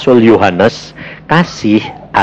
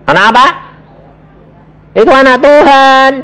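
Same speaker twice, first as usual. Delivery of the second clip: calling out; 11 semitones higher, 3 dB louder than usual. Acted. Pengkhotbah berseru tanya jawab.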